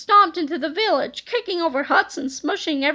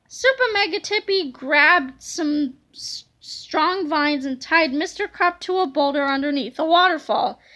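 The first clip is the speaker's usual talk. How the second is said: ordinary delivery, nothing marked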